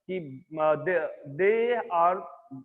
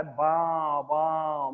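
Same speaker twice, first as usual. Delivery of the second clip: imitated calf bawling; 3 semitones lower, slower than usual. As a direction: imitate a calf's long call, understated. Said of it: "जोर से चिल्ला रहा है". (बछड़े के रंभाने की हल्की नकल)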